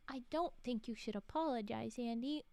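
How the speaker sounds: usual speaking voice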